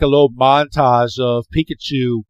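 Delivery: ordinary talk, nothing marked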